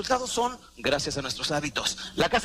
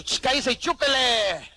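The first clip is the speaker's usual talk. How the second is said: slow, louder shushing order; bossy hush; 6 semitones higher, 4 dB louder, slower than usual. Retold or imitated. The smoker silencing his own body, slow and bossy. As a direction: shut the body up and keep smoking. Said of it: "Y tú".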